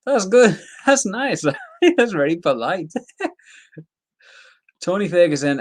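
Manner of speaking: real chuckles breaking up a high, amused remark; amused laughing. Real, not acted. Reacting to a fighter's polite insults, laughing.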